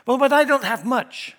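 high, loud imitated objection; mock protest. Believer objecting that he has too little, high mock protest.

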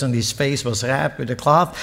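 ordinary delivery

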